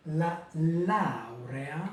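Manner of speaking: slow careful Italian laurea; slow teacher model of Italian; Italian model word. Shown as a demonstration. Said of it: "Vous avez sans doute remarqué".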